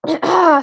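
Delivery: clearing an itchy throat; harsh throat clear. Real, not acted. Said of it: "my throat is itchy".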